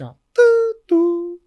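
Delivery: high loud mock ding-dong of a cabin intercom; intercom chime imitation. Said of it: "e o comandante faz assim".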